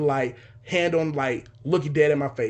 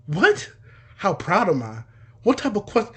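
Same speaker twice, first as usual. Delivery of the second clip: baffled panic; 5 semitones higher, 3 dB louder than usual. Retold or imitated. Retelling his panic at the question.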